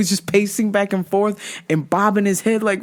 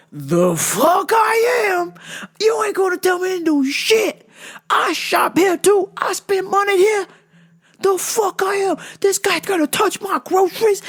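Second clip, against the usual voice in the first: furious shout; 10 semitones higher than usual. Retold or imitated. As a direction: blow up at the boss, screaming. Man explodes at the boss.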